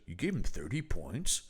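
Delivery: quiet, earnest incredulous question; very serious. Retold or imitated. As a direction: imitate a guy asking softly, in all seriousness. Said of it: "one guy who very seriously goes".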